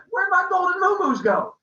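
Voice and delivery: high, loud wail of dismay; upset cry. Acted. Storyteller as Apollo discovering his cows are gone, upset high cry.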